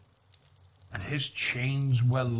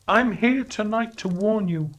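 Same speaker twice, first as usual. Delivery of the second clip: high, louder mournful spirit; Marley's ghost lamenting; 7 semitones higher, 5 dB louder than usual. Acted. Marley's ghost lamenting his chains to Scrooge, high and mournful. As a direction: wail out regret.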